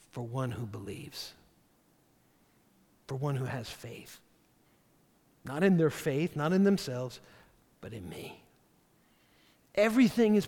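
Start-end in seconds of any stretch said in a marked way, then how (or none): 0.1-4.2 hushed. Acted. Preacher softens Jesus' words. 7.8-8.4 hushed. Acted. Soft emphatic ending.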